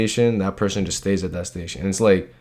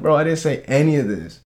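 indignant retold denial; 7 semitones higher than usual. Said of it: "I'm like".

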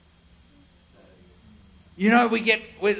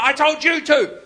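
annoyed disciple; 6 semitones higher, 5 dB louder than usual. Retold or imitated. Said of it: "It's like".

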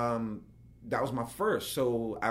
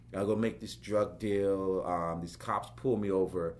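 normal talking voice

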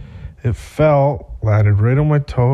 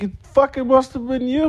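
very high, panicked mock accusation; retold frantic accusation; 3 dB quieter than usual. Heather blaming him for the blood, high frantic mock shriek. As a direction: shriek the blame at him in imitation. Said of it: "she was kinda screaming frantically".

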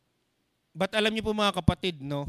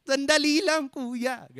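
high, tearful plea to wait, breaking into a laugh; high pleading voice cut by a laugh; crying churchgoer; 9 semitones higher, slower than usual. Pretend. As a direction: play a sobbing listener begging for a moment. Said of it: "Huwag niyo pigilan yung naram".

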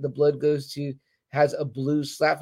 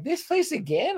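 a much higher, incredulous "this place again?"; exasperated disbelief; 11 semitones higher than usual. Retold or imitated. Fan griping at the show about the Stepstones, incredulous. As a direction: roll your eyes in disbelief. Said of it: "I'm like".

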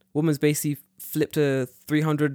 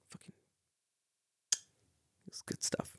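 whisper; 16 dB quieter than usual. Retold or imitated.

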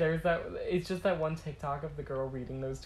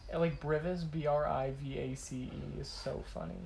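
usual speaking voice

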